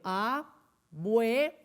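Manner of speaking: "abuelo" very slow, long pauses between syllables; three isolated beats on "abuelo"; split into syllables. Shown as a demonstration. Teacher dividing the word for children, "abuelo" split slowly. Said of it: "Tiene tres sílabas".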